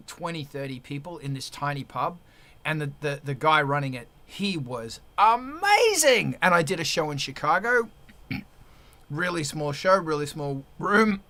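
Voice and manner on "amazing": high, loud, drawn-out burst of admiration; emphatic praise. Real, not acted. Praising the guy running a tiny pub gig, emphatic. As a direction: stretch it out big and high.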